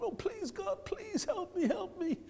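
high, soft mock plea for help; a lightly voiced beaten believer begging God; pleading to God. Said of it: "and he pleads to God".

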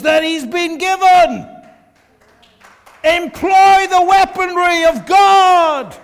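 high, loud shouted exhortation; rally the church to fight, shouting; preaching at full force. Real, not acted. Preacher rousing the congregation to take up God's weapons, shouting.

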